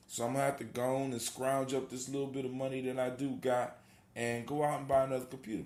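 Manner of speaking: usual speaking voice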